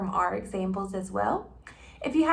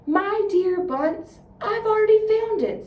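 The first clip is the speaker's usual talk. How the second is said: crafty Bean; 10 semitones higher, 6 dB louder, slower than usual. Acted. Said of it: "said the crafty Bean".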